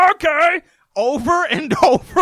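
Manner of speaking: high nasal-free squeaky "Okay" like Pee Wee; Pee Wee Herman voice. Retold or imitated. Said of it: "He sounds like Pee Wee Herman".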